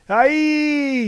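high, loud whoop; yelled cheer. A narrator's reading. Tithonus yells, throwing the cart off the porch. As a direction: yell it as you heave the cart. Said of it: "yelled Tithonus".

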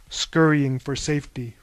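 plain reading voice

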